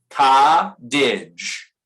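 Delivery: "Cottage" repeated firmly with a D-like flap. Shown as a demonstration. Teacher repeating the word so the student copies it, firm and clear. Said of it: "this is cottage".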